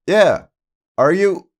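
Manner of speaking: high, loud holler; yelling across. Retold or imitated. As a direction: shout the question across a diner. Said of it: "we're both yelling back and forth".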